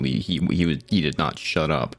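normal talking voice

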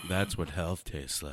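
slow, soft, savoring; satisfied. Real, not acted. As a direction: relish the drink, unhurried. After sipping kombucha.

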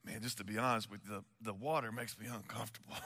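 mock a big tough guy confessing his fear; quiet, embarrassed murmur imitating timid Bill; timid admission. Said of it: "he's timid again".

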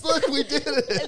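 a coworker showing off to colleagues, high and giddy; excited coworker. Retold or imitated. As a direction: squeal it like a proud office worker. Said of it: "he showed all his coworkers".

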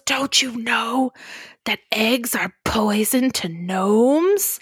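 slow, lightly voiced villain king; Gnome King. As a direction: drawl it like a dying clay king. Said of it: "the king goes".

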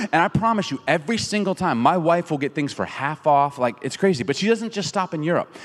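ordinary talk, nothing marked

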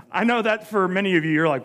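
usual speaking voice

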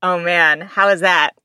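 ordinary delivery

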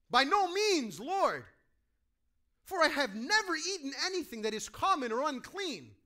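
loud, high, firm refusal; Peter refusing. Acted. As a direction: push back hard and high, like a shocked devout man. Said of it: "Peter refuses".

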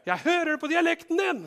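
"Jeg hører det på dialekten din" cried out very high and loud; shrieking accusation. Acted. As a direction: shout it so everyone hears. Accuser repeats, higher. (skingrende høyt rop)

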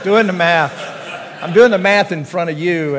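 chuckling through "Doing the math" while counting aloud; laughing. Real, not acted.

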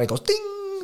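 high sung ding like metal; crossbar ding. Retold or imitated. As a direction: ring out the ding like a struck goal frame.

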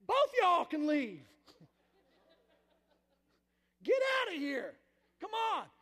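big acted outburst from an exasperated dad; retold loud dismissal. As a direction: throw the complainers out, loudly.

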